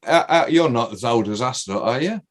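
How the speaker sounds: normal talking voice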